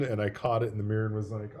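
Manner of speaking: ordinary talk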